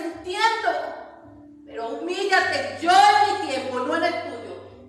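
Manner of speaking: rising, building voice of God; the Lord speaking, growing stronger with each "Entiendo". Acted. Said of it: "Él dice".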